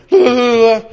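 high, loud mock wailing; comic meltdown in nonsense syllables; freaked-out wail. Acts out his freaked-out reaction.

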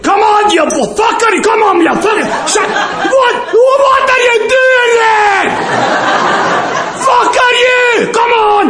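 high, raging mock shouts; furious Scot. Scottish drunk yelling at the fly to spit out his beer, high furious shouts. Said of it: "The guy from Scotland is pissed".

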